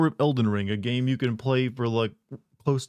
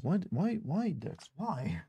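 high, breathy pleading to the dog; whiny despair; 6 semitones higher, 8 dB quieter, much slower than usual. Real, not acted. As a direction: wail it in quiet disbelief. Dog soiled his keyboard.

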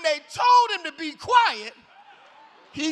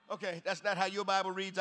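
ordinary talk

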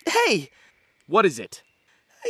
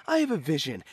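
usual speaking voice

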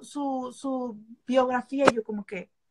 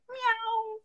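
playful meow; 9 semitones higher than usual. Pretend. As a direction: purr out a flirty meow. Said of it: "hago así como que".

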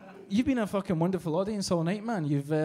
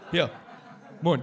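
Scottish hawker call. Retold or imitated. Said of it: "Scottish guy going up to people going".

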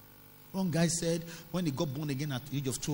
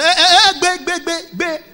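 very high, loud staccato outburst; mock pastor's piercing shout of objection; shouted interruption; 15 dB louder than usual. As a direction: cut in with rapid alarmed yelps.

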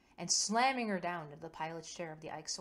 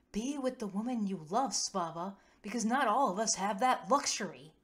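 raised, impassioned outburst in narration; heightened emotion; 4 semitones higher, 9 dB louder than usual. A narrator's reading. Kova overexposes herself to Svava, impassioned and loud. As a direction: lose yourself in it, louder and higher. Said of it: "moment of heightened emotionality".

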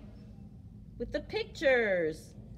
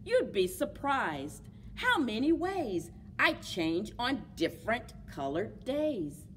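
put-on reading to children. A narrator's reading. Teacher reading My Many Colored Days, storytime voice. Reads picture book aloud.

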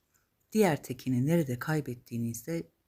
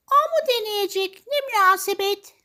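very high, loud stepsisters; high-pitched haughty storybook protest; outraged cry; 8 dB louder than usual. Acted. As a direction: shriek in indignant disbelief. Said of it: "diye haykırmış üvey kardeşler".

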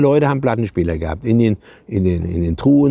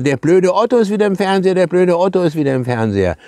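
put-on kids shouting; 7 semitones higher than usual. Children yelling at the TV about Otto, mock shout. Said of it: "auf einmal schreien die Kinder".

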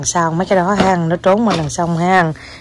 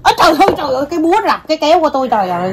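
mock dismay; 6 semitones higher, much faster than usual. Mom loses rock paper scissors.

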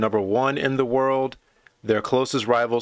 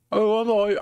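high, bad Irish accent; attempted Irish accent; 8 semitones higher than usual. Shown as a demonstration. Fan trying out an Irish accent, giving up midway. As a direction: have a clumsy go at an Irish accent. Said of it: "how to do an Irish accent".

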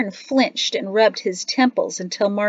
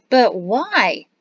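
raised, loud demand for a reason; frustrated child's challenge; angry question; 4 semitones higher, 6 dB louder than usual. A narrator's reading. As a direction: demand it, pitched up and cross. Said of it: "Now Peyto was angry".

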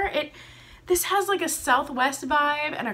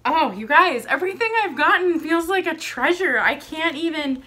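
louder and faster burst of joy; excited gush; 4 semitones higher, 6 dB louder than usual. Real, not acted. Telling viewers every item feels like treasure, excited. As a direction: blurt it out overjoyed.

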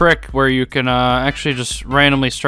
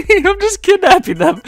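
laughing. Real, not acted. Laughing at own joke.

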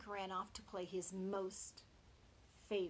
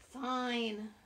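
whine; 4 semitones higher, 8 dB louder than usual. Acted. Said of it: "whined the swine".

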